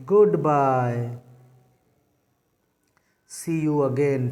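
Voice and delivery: drawn-out, slow goodbye; slow farewell demo. Shown as a demonstration. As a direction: say goodbye slowly, like waving someone off. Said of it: "तो जैसे हम लोग क्या बोलेंगे?".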